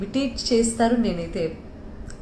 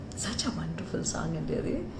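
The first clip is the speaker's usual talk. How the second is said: whisper; 5 semitones lower, 9 dB quieter, slower than usual. Real, not acted. Fan's comment, whispered.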